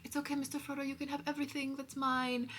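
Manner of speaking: high, hushed parody of selfless devotion; Sam. Acted. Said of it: "and Sam being like".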